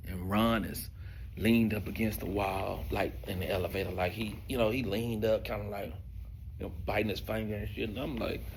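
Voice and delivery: normal talking voice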